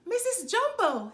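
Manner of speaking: high, loud call across the train. A narrator's reading. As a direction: call her name from afar. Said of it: "he called".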